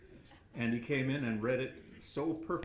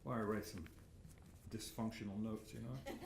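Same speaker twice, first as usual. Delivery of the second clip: ordinary delivery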